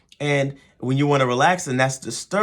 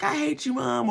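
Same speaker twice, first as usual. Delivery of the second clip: put-on much higher pitched sulky kid voice; bratty kid; 10 semitones higher, 4 dB quieter than usual. Kid snapping back at the parent, high mock whine. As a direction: whine it like a resentful teenager. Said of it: "And the kid's like".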